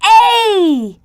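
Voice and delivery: high, loud single shout; children shouting out their letter. Acted. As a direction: call it out to everyone. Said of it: "They held up A and shouted".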